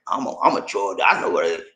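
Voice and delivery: low-pitched mock know-it-all; know-it-all attitude. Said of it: "when you come with a know-it-all attitude".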